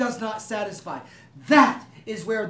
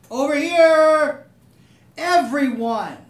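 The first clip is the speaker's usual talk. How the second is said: calling out; 5 semitones higher, 10 dB louder, much slower than usual. Pretend. God calling for attention.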